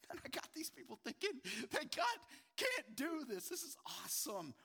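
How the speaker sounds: a hint of a smug devil rubbing his hands; the devil gloating. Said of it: "the devil, who's like".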